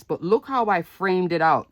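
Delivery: usual speaking voice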